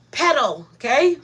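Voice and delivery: normal talking voice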